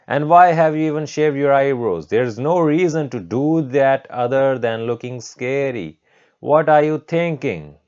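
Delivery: loud, high indignant demand; shrieking teacher. Acted. Teacher berates a student over shaved eyebrows, shrieking. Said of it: "started shrieking".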